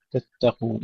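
ordinary talk, nothing marked

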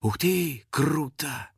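stretched, mildly awed exclamation; drawn-out. A narrator's reading. Girl watching star leave, drawn-out wonder. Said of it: "протянула Кристина".

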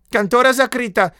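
very high, loud frantic cry; sudden shout. A narrator's reading. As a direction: shriek the office shut. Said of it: "закричал вдруг писарь". (внезапный высокий крик писаря)

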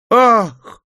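loud high startled gasp-cry; cry of fright. Acted. Officers and brides crying out in fright. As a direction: shriek of shock. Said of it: "Громкий крик испуга и изумления".

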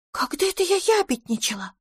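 возмущённый высокий вопрос; обиженно вскинуться на брата; возмущённо. A narrator's reading. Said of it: "возмутилась Пипина". (возмущение, высоко и тише)

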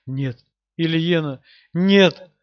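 rising into a desperate cry of no; anguished shout. Acted. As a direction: build from stunned to screaming. Hero grieving over wife.

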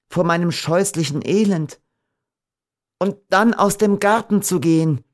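high, sorrowful heroine; deeply grieving Beatrice. A narrator's reading.

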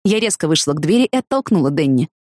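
usual speaking voice

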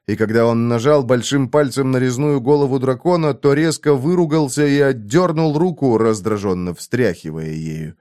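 ordinary delivery, nothing marked

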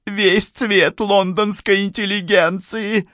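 very high, slow tearful wail; sobbing. A narrator's reading. Mrs. Amberley goes on with her grievance, sobbing in a high voice. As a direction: keep wailing high and drawn out. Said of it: "продолжала всхлипывать миссис Эмберли".

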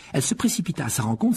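ordinary delivery, nothing marked

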